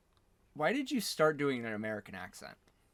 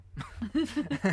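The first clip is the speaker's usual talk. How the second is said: hearty laugh; 11 semitones higher, 4 dB louder than usual. Real, not acted. Host laughs at sitcom remark.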